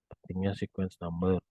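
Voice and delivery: usual speaking voice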